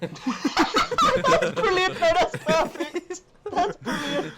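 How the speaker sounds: high, slow words squeezed out through laughter; laughing hard. Real, not acted. Co-host cracking up at the mock voice, laughing through words. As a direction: laugh through the words, barely getting them out. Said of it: "laughing at the impression".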